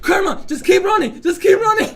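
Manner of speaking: high, loud, laughing yell; frantic shout. Acted. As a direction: scream it while running. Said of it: "She's like".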